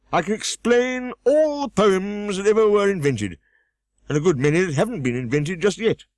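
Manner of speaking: Humpty Dumpty scolding. A narrator's reading. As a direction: snap at the girl from the wall.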